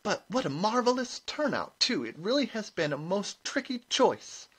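very high, gushing brightness; high-pitched, bright prize-giving voice. A narrator's reading. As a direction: gush brightly to the crowd. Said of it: "she said brightly".

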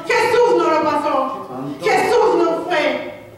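loud, high, fervent sermon cry; preaching at full force. Acted. Impassioned preaching climax. (predicación a todo pulmón)

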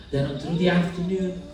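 plain reading voice

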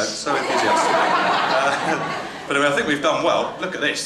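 ordinary talk, nothing marked

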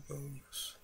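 ordinary talk, nothing marked

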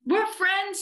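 put-on high, happy child tone; little-boy voice. A narrator's reading. Eddie to his new friend, in little-boy voice. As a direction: declare it high and simply.

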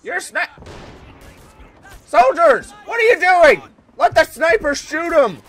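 high, loud exasperated yell; shouted orders. Pretend. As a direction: holler at the troops over the battle. Gamer yelling orders at soldiers.

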